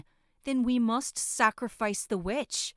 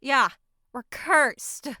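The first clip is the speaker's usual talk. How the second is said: louder, higher cry of doom; cursed outcry; 5 semitones higher, 9 dB louder, slower than usual. Acted. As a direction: announce the curse in alarm. Villager cries out in fear.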